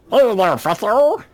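high-pitched cartoon critter greeting; Jackalope. Co-host imitating a home-video show character, high critter voice. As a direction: squeak out a comic TV creature voice. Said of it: "Jackalope from the old".

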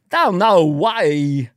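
very slow, bending syllables; odd, stretched cadence; Christopher Walken. Acted. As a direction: draw out the bafflement word by word. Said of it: "Christopher Walken sees an amazing magic trick".

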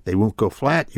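ordinary delivery

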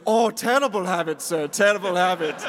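loud mock German tutting; German Willy Wonka. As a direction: scold theatrically in a German accent. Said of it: "like, a German Willy Wonka".

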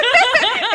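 high loud cackling laugh; loud burst of laughter. Real, not acted. Laughing at Australian accent idea.

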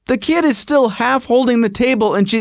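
plain reading voice

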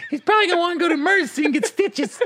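high laughing imitation of a friend; mock Brad voice. Friend mocking the injury, high and laughing. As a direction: pitch it up and laugh through it.

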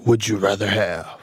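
movie announcer voice. Pretend. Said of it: "give me your movie announcer voice, very deep".

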